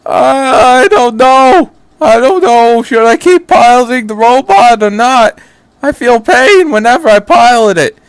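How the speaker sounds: mock a hand-wringing, indecisive boy; mocking his endless whining; whiny Shinji impression. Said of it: "all you do is just go".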